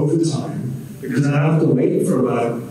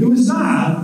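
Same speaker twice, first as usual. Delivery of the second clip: normal talking voice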